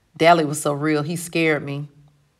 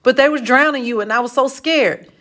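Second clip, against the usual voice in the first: high, pleading, scared confession; blurted out; 7 semitones higher, 3 dB louder than usual. A narrator's reading. Johnny explains the killing to his friend, blurting it out scared. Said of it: "he finally blurted out".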